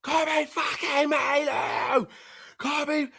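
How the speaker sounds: high, drawn-out celebration cry; mock goal celebration. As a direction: stretch the name like a stadium call. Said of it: "I'm doing the".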